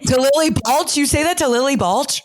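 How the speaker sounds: high, incredulous rising question; can't believe what she just heard; shocked disbelief. Real, not acted. Host reacting to guest hating yoga, mock-shocked and high.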